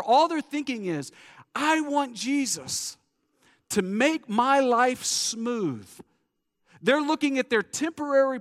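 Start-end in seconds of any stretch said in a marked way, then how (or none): 1.5-5.9 slow wishful crowd. Acted. Crowd's wish voiced slowly.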